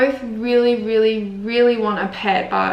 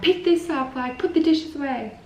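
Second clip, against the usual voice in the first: higher-pitched bossy orders; 6 semitones higher than usual. Retold or imitated. Imitating herself about cleaning the house, playfully bossy. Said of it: "I can be a little bit bossy".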